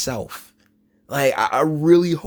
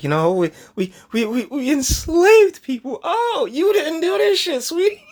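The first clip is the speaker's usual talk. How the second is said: much higher, hammed-up crying voice, louder; high, wailing mock-weepy impression, louder; mock tearful woman; 5 dB louder than usual. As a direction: parody her breaking into sobs, laughing through it. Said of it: "She's like".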